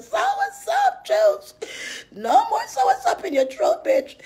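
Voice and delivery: squealed very high, laughing; falsetto mock. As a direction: shriek it in a mocking falsetto.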